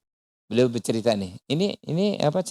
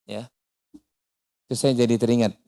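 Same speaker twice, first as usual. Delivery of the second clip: ordinary talk, nothing marked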